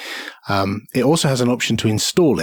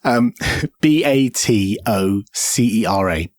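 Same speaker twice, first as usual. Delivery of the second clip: ordinary delivery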